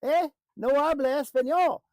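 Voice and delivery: loud, high shouted retort; hammed-up yelling match; mock shouting back. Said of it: "So you're gonna yell at me louder?".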